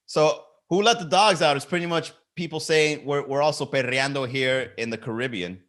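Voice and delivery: ordinary delivery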